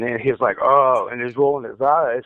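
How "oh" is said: mildly cocky, louder retold "oh"; a touch of mock cockiness. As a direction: imitate a dismissive, cocky reaction. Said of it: "just being real cocky".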